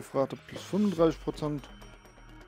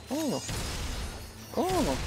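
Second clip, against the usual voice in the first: surprised; 11 semitones higher, 5 dB quieter than usual. Real, not acted. Surprise at game event.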